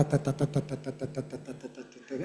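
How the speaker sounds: steady machine-gun ta; even ta syllables. Shown as a demonstration. Teacher mimicking Spanish syllable-timed rhythm. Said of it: "we hear Spanish as ta".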